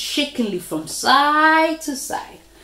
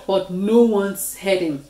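ordinary delivery

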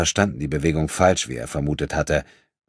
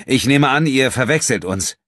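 hasty; 9 semitones higher, 8 dB louder than usual. Acted. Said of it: "sagte André rasch".

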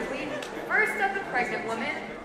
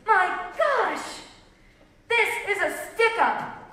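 raised, higher-pitched exclamation; robber's cry; 7 semitones higher, 6 dB louder than usual. A narrator's reading. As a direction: cry it out like a stick-up man. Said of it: "cried the strange man".

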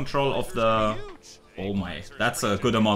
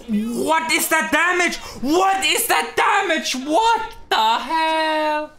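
high, slow, stunned outcry; shocked disbelief; 5 dB louder, slower than usual. Real, not acted. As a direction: cry out in disbelief at the damage. Shocked at enemy damage.

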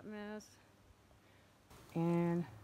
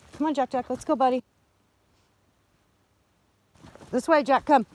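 loud, higher call to the dog; 5 semitones higher, 14 dB louder than usual. Real, not acted. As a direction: call Jack back to the path. Calling dog Jack on trail.

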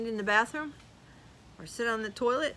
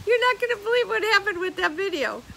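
high laugh spilling into loud words; 13 semitones higher, 13 dB louder than usual. Real, not acted. Vlogger laughing loudly about a viewer's music complaint. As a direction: burst out laughing then tell it.

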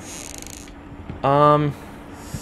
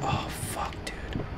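tense whispered curse; whispering; 11 dB quieter, slower than usual. Real, not acted. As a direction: breathe it out in dread. Whispered in tension.

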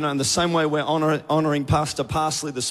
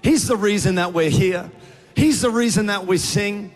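raised, earnest prayer voice; fervent prayer; 4 semitones higher than usual. Acted. Pastor praying over the congregation, fervent and earnest. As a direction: lift the church up in heartfelt prayer.

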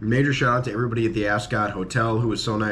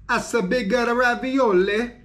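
hammed-up Italian accent, much higher; 13 semitones higher, 4 dB louder than usual. Part-Italian vlogger admiring ravioli, hammed-up Italian accent. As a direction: play the proud Italian cook in an Italian accent. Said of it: "so I'm allowed to do the accent".

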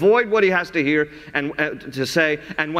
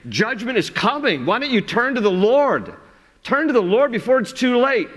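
higher, urgent plea to turn back; pleading prophet; 7 semitones higher than usual. Retold or imitated. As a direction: beg them to turn before it's too late. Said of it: "He's like".

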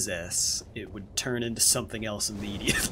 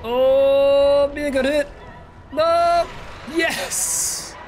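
loud, high, desperate pleading; tense high shouts; 13 semitones higher, 5 dB louder, much slower than usual. Real, not acted. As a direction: plead with the game loudly. Tense moment in fight.

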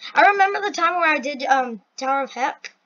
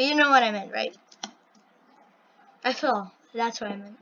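ordinary delivery

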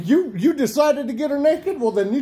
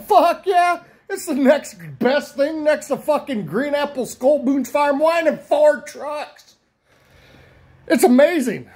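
higher-pitched, gushing endorsement; emphatic enthusiasm; 5 semitones higher than usual. Retold or imitated. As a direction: retell it as an emphatic, over-the-top rave. Enthusiastic quoted answer to friend.

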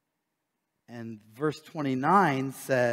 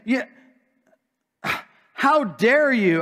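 mock indignation; 9 semitones higher, 7 dB louder than usual. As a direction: throw it back at them with mock outrage. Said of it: "He's saying".

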